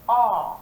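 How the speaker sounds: aw said long and loud as an open aw; teacher models the aw sound loudly; sound demo. Shown as a demonstration. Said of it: "It's the sound".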